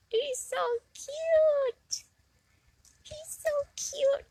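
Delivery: high cooing voice to a tiny critter. Real, not acted. Cooing high at a tiny bug she found.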